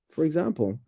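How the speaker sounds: ordinary delivery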